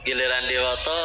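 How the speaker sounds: ordinary delivery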